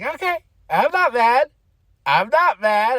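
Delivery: high, loud, unconvincing "not mad" imitation; mock denial of anger. Imitating Liv answering Dominic's apology, mock denial of anger.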